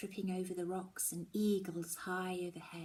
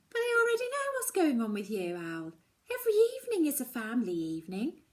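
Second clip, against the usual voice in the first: mother's reply; 5 semitones higher, 6 dB louder, faster than usual. Acted. Mum answers the cross daughter.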